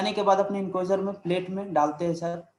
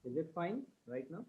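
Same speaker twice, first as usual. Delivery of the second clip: faint question, far from the mic; asked too softly to hear; barely audible; 18 dB quieter than usual. Real, not acted. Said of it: "No, sir, it's less".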